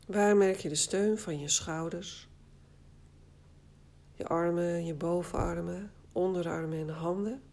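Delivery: ordinary delivery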